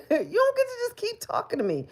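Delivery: high, loud, half-laughing outburst; high cracking rant at trolls; exasperated outburst. Real, not acted. Angry at trolls.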